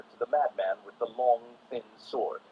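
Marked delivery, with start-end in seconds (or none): none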